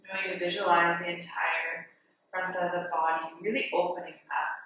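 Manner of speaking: guided meditation. Real, not acted. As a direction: guide them gently into the breath, softening on the counts. Leads breathing meditation.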